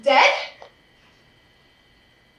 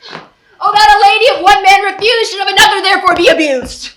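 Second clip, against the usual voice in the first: much louder and quick, indignant; outraged outburst; 3 semitones higher, 9 dB louder than usual. Acted. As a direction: spit it out fast in wounded fury. Helena storms off in outrage.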